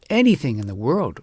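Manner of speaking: ordinary delivery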